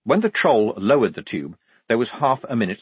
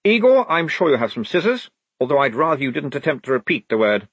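higher-pitched, particular officer; officious lieutenant; 5 semitones higher, 4 dB louder, faster than usual. Acted. Lieutenant asking Igor for scissors, politely officious. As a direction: make a polite but fussy order.

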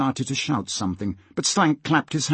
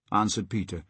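ordinary delivery

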